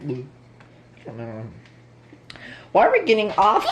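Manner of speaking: ordinary talk, nothing marked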